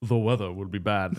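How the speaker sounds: lightly voiced low, soft, unbothered meteorologist; underplay the character, low and quiet; Andrew Scott impression. Andrew Scott walks in with the forecast, understated and low. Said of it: "Andrew Scott walks in there and he's like".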